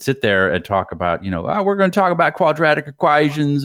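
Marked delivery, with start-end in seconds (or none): 1.5-3.6 mimicking a Southern math professor's accent; Southern professor impression. Imitating his college math professor in a Southern accent. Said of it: "deep, deep Southern accent".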